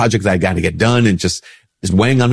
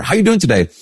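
a higher, lilting mock greeting; cheerful greeter; 10 semitones higher, faster than usual. Imitating an acquaintance's greeting, sung out higher. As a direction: chirp it like a friendly passer-by.

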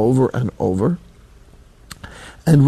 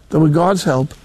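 usual speaking voice

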